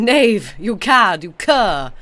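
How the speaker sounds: loud, hammed-up Victorian insult; theatrical mock indignation; mock outrage. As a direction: fling it like a scandalised gentleman.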